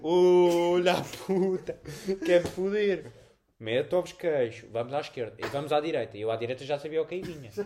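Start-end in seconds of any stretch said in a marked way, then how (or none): 0.0-3.1 slow, louder cursing; half-joking loud curse; mock angry outburst. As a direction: curse the machine drawn out. Reacting to the second air puff.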